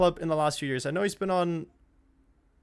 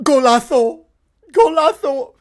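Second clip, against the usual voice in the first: loud, high Spanish-style goal call; commentator goal shout; 6 semitones higher, 10 dB louder, slower than usual. Real, not acted. Streamer celebrating the goal, loud commentator call. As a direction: belt it like a TV commentator.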